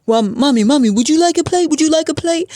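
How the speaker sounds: high, fast sugary offer; imitating the Spanish guy. Spanish guy offering her a plate, high mock sweetness. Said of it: "he said".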